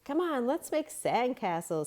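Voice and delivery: high, bright invitation; rally friends to play; eager girl voice. A narrator's reading. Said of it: "Stephanie said".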